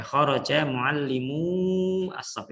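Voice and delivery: kharaja muallimu as-saff read slowly, every syllable clear; careful slow Arabic reading; slow model reading. Shown as a demonstration. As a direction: model the sentence slowly for students. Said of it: "Jadi bacanya gitu ya".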